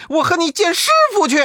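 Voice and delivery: the rival echoing back, shriller; rapid high-pitched echo. Acted. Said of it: "口中不住地说".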